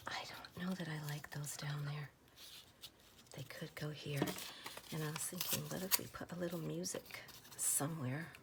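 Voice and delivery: hushed, breathy ASMR murmur; soft whisper. Acted. Crafter whispering to viewers while sorting stamps, soft whisper. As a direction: whisper it close to the mic.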